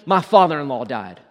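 loud, lightly acted list of grievances; mock complaining. Preacher voicing what Ruth could have said, lightly acted complaining. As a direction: pile up the complaints, punching each one loudly. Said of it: "sat down and complained".

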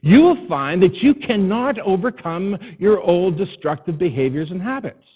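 preaching. Acted. Pastor exhorting his congregation, full preaching voice.

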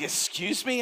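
put-on playfully affronted teenager; offended. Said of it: "And I was very offended".

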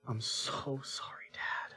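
put-on slow whispered child's apology; hushed, drawn-out contrition; remorseful whisper. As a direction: imitate a sorry kid, barely audible.

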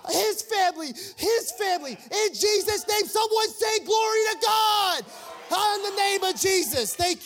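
high, full-throated shouted preaching at a climax; excited preaching. Acted. Preacher telling believers God is their proud father, ecstatic shout. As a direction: proclaim the Father's love at full force, drawn-out cries. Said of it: "That's how excited I get".

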